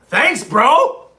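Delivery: loud, high mock thanks; mock gratitude. Streamer answering someone who insulted him, loud mock gratitude. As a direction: squawk a sarcastic thank-you, rising sharply.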